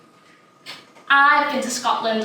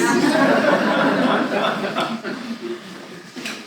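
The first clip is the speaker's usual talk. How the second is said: giggle. Real, not acted. Giggling after saying rude word.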